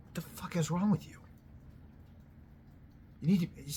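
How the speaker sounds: exasperated outburst. Real, not acted. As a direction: let the frustration burst out.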